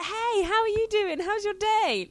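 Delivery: high, loud, slow sing-song hello; overly cheery greeting. Retold or imitated. Greeting the bus driver, high loud over-friendly hello. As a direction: beam at the driver like an eager idiot. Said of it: "I'm like".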